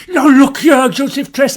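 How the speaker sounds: loud, sharp opener; angry confrontation. Acted. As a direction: call him out hard. Pugh confronting Tress angrily.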